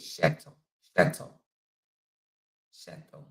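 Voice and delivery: "Shetto" said low and soft with an SH start; hushed repeats of "Shetto", opening on "sh"; CH pronunciation. Shown as a demonstration. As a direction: practise "Shetto" quietly to yourself. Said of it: "She's more like C H".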